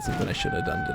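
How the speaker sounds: high panicked squeak; startled squeak. Real, not acted. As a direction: gasp out a sudden worry. Sudden realising a mistake in game.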